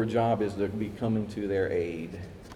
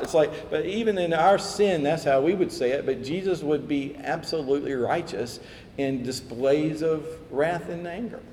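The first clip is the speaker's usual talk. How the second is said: ordinary delivery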